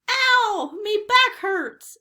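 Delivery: high, loud wail of complaint; crying out. A narrator's reading. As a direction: cry out in pain, drawing it out. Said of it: "Stinky cried".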